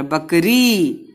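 बकरी said slowly with a long ई; slow drawn vowel. Shown as a demonstration. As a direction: stretch the ई of बकरी. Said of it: "रा पे बड़ी की मात्रा".

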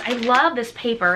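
normal talking voice

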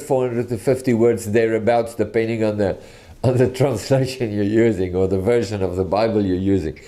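ordinary delivery

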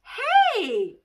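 high, loud, thrilled "Hey"; excited child's cry. Acted. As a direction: burst out with delight at the discovery. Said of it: "very excited".

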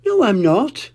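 high, mildly cross denial. Acted. Susie crossly denying to Mummy Pig, mild and high. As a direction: snap back like an offended child. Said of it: "Susie said crossly".

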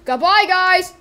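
high, loud goodbye; shouted farewell. Acted. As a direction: call out a hammed-up last farewell. Dying character's farewell.

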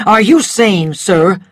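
angry gentleman demanding an answer; an offended man confronting Rhett. A narrator's reading. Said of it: "an angry voice arguing with him".

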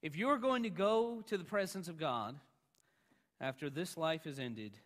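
ordinary talk, nothing marked

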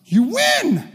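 high, loud preacher's shout; exultant, full-voiced cry; triumphant shout. Real, not acted. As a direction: shout the victory to the whole room. Climax of the preached promise.

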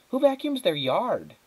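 can't believe what she sees; incredulous. Acted. Flora thinking in disbelief at the window, high.

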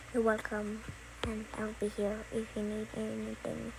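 low, soft monotone; name yourself low and evenly; put-on character voice. Child back in Negative Three role, low. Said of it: "this is just a good voice impression".

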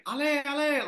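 high-pitched mock cheering, not full volume; imitated crowd yelling. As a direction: mimic fans yelling encouragement in a high voice. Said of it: "sort of start yelling".

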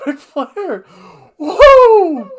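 shrill, very high, loud woo; Ric Flair woo. Retold or imitated.

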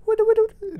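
sing-song squeak for a rock wobbling; wiggling rock noise. Retold or imitated. Podcaster mocking the rock's tiny wiggle with a high squeaky noise. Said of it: "the rock just, like, goes".

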